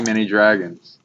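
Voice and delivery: ordinary talk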